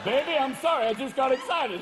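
apologize, caught off guard; mock flustered apology. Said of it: "hang out of your shorts like".

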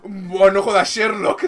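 hammed-up exasperated operator; mock sarcastic retort. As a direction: scoff at the obvious question. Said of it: "Y dice".

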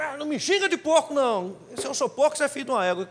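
mock offended driver. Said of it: "e ele tava".

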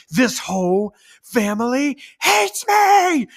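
slow, high and loud wail of injustice; hammed-up offended kid yelling; stomping kid tantrum. Offended son stamping around the house, slow loud wail. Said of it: "he would stamp around and he'd be like".

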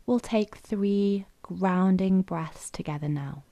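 unhurried, even delivery, leaving room to breathe; guided meditation. Acted. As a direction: guide them gently into stillness, phrase by phrase.

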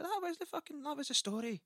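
very high, squeaky mock indignation; indignant protest. Poet argues with his heckler, high and indignant. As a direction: argue back in a high-pitched voice. Acted quarrel over the story.

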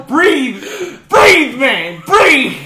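high urgent breathing coach; calm your panicking friend urgently; frantic coaching. Acted.

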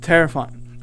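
ordinary delivery, nothing marked

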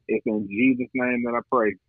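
normal talking voice

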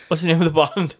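normal talking voice

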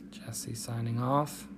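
soft unvoiced whisper. Real, not acted. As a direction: whisper the sign-off. Whispered sign-off.

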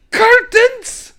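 very high loud bark, laughing; shouted word. Retold or imitated. Father Jack naming curtains, barking loudly. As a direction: yell it out like a confused old man.

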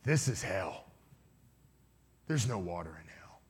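low, menacing beast voice; hell beast. Retold or imitated. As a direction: pronounce doom in a deep, low voice. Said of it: "the beast started laughing and said".

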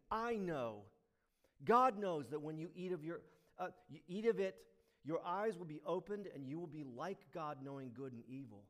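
luid en stellig; strong words. Acted. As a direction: overtuig hen met kracht. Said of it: "This is strong words".

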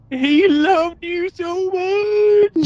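very high, slow, drawn-out sobbing wail; mock crying wail. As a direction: parody a weeping widow. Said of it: "crying her eyes out".

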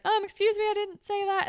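high, offended mock denial; indignant. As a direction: clutch your pearls and deny it. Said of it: "Emma's like".

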